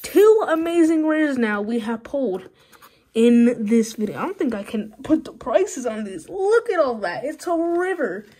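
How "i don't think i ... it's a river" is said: ordinary talk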